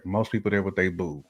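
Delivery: ordinary talk, nothing marked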